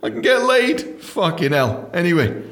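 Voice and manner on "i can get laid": high, laughing mock virgin bluff; nervous virgin. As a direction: ham up a nervous first-timer faking experience. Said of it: "like when you're losing your virginity".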